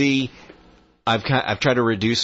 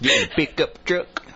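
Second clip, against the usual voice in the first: higher put-on country accent; 8 semitones higher than usual. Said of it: "talk with country accents".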